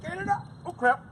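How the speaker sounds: high, loud nervous hollering; excited yelling. Real, not acted. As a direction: yell while losing balance. Yelling while riding the ramp.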